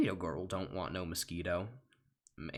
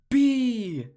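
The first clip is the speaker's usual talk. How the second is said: much higher, loud desperate yell; shout; 14 dB louder than usual. Acted. Bug yelling for his friend in the wiper fluid. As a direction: scream his name as you are swept off. Bug calls out while washed away.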